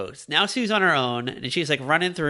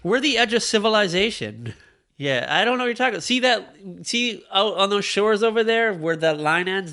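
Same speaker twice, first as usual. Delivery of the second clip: higher, hammed-up old-world peasant; mock medieval villager; 6 semitones higher than usual. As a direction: point to the horizon like a spooked villager.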